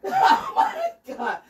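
slow, very high laughing "Oh my God"; laughing groan. Real, not acted. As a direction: laugh through the soreness. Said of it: "I'm so sore".

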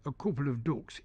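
"ducks" in Northern English, rhyming with "books"; Northern English accent. Shown as a demonstration. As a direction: slip into a Northern English "coople of dooks". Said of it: "So if you said".

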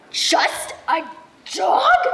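loud, indignant, incredulous echo; insulted, rising disbelief; offended outburst. Acted. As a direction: throw the words back, jumping high on the last. Insulted dog repeats mom's words.

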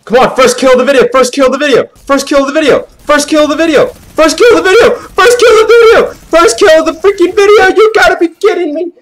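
high, loud chant building to a scream; frantic repeated shout. Acted. Gamer demanding first kill of the video, frantic escalating scream. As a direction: start shrieky and climb into an all-out screech.

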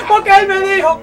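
loud, high melodramatic wailing; hysterical wailing wife. Acted. Wife to doctor, loud high hammed-up wailing. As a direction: howl it like a soap opera widow.

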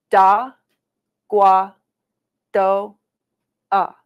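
teacher's slow syllable-by-syllable model. Shown as a demonstration. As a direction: say "Da, gua, do, ah" piece by piece, pausing after each. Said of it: "We'll say it slow".

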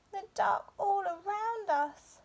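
high small voice of a scared cub; high-pitched timid bear cub; little bear cub. A narrator's reading.

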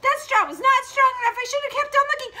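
shrieking, very high and loud, words tumbling; panicked piggy. Acted. Piggy flees to brother's house, shrieking in panic. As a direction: squeal for help in a frantic rush. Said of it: "and the piggy says".

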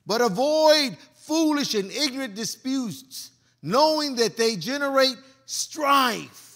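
loud, slow preaching of scripture. Acted. Pastor reading scripture to the congregation, loud and slow. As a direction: proclaim the verse slow and loud to the church.

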